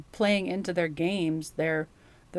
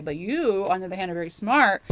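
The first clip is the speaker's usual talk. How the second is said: ordinary talk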